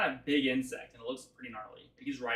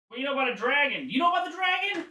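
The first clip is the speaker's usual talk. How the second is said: high, loud rush of alarm; amazed little creature, climbing higher; startled charmed creature; 12 semitones higher, 8 dB louder, faster than usual. Acted. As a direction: blurt it out in a squeaky monster voice.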